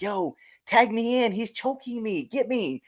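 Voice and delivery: high, urgent call for rescue, held back in volume; mock desperate plea. Wrestler begging a ringside kid for a tag, mock desperate.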